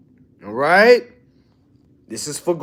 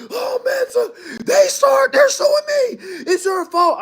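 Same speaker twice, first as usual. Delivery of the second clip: whiny panicking client. Retold or imitated. Mocking a client who blames him for getting sued, high whiny wail. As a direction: ham up a frantic client blaming you.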